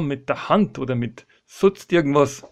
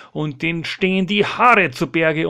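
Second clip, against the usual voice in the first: usual speaking voice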